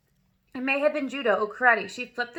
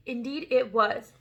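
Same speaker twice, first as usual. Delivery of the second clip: ordinary delivery, nothing marked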